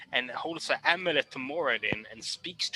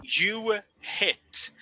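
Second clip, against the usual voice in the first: ordinary talk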